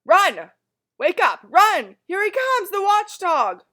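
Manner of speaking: very high, very loud shrieks; panicked shouting. Acted. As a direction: yell the alarm as he comes. Townsfolk flee the watchdog.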